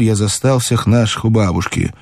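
plain reading voice

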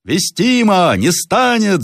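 high, loud chorus of agreement; crowd shout. A narrator's reading. Peasants agreeing no Russian officer would sing so, shouting. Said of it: "закричали крестьяне".

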